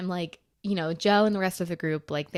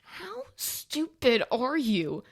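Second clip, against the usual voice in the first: very slow, raised-pitch retold jab; exasperated scolding; 5 semitones higher, much slower than usual. Scolding Joe about the men following, exasperated. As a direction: drag out each word in exasperation.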